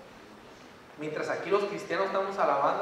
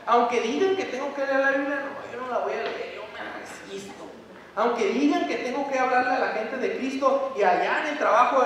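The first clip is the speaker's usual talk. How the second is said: higher mocking voice, digging in; pouty higher mimic of a lazy believer; 5 semitones higher, 5 dB louder than usual.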